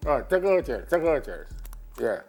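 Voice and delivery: normal talking voice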